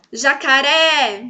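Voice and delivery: jacaré, last syllable long and loud; slow demo of jacaRÉ, ending swelling; stress on RÉ. Shown as a demonstration. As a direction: draw out and push the RÉ in jacaré. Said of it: "se eu falar também eu vou saber".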